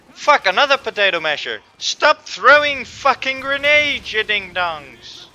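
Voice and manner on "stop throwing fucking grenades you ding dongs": high, loud, slow exasperated yell; shouting at his own team, fed up; angry shout. Real, not acted. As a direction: bellow at careless teammates. Teammates throwing grenades at him.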